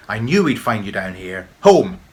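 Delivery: stern, building to a loud shout on Home; angry mum. A narrator's reading. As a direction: scold him and order him home. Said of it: "barked Tam's mum".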